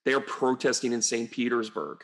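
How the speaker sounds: normal talking voice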